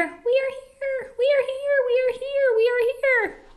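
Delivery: very high, tiny crowd voice; fearful crying out. A narrator's reading. Frightened Whos cry out to be heard, high and tiny. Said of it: "They cried out in fear".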